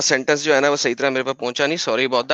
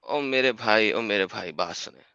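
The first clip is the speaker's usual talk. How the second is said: low, soft, sinking plea for attention; subdued appeal; 6 semitones lower, 8 dB quieter than usual. Real, not acted. Debater to opponent who talks over him, subdued appeal. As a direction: let the voice drop away, softer with each phrase.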